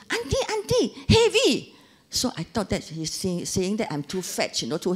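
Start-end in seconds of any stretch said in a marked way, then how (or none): 0.1-1.7 much higher, laughing calls of auntie; chirpy eager helper calling out; foreign worker calling. Retold or imitated. Foreign worker calls to auntie about her heavy bags. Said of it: "and he said".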